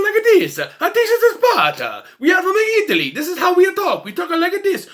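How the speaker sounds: loud, high-pitched cartoon Italian accent; hammed-up Italian accent. Showing how the Romans should talk, mock Italian accent. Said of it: "They need Italian accents".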